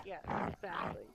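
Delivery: whispered agreement. Retold or imitated. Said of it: "You're supposed to be like".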